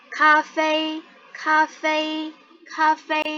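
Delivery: "Cafe" held high and level; model pronunciation. Shown as a demonstration. Teacher reads first for the student to follow, "Cafe" in high level tones. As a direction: say "Cafe" as ka-fei, both syllables drawn out on a high, even tone. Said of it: "aku baca duluan, kamu ikutin".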